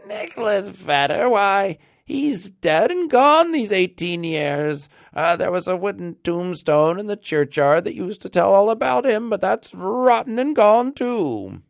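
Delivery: put-on very high, thin old man's voice; thin piping old man. A narrator's reading. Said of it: "an old man replied in a thin, piping voice".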